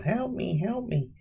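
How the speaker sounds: squeaky, high, soft little cry for help; tiny fly voice. Retold or imitated. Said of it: "He was in The Fly".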